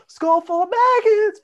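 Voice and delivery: high, loud, slow imitated yell of a song title; trying to yell. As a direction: announce the song with a straining scream. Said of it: "and yelled or tried to yell".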